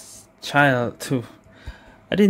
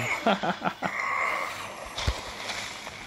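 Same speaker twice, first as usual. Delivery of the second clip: long, soft run of chuckles; gleeful laugh; 4 semitones higher, 11 dB quieter than usual. Real, not acted. Player laughing after landing the burst, gleeful. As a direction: laugh it off softly after a big hit.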